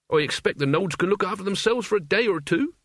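Mort, unworried lad. Acted. Mort reassuring Albert about nodes, lightly voiced lad. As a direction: brush the worry off lightly.

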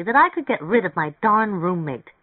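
lively, insistent woman character; energetic. A narrator's reading. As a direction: speak up with eager energy. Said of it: "said energetically".

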